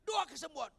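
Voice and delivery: high, forceful shouted answer; loud shout. Retold or imitated. Pastor urging prayer for four-year-old, shouting. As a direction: belt it out without hesitation. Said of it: "Saya keras langsung".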